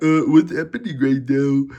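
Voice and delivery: mock baffled voice; confused Bills fans. Mocking Bills fans watching the turnover, baffled. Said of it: "bums that picked the Bills win were like".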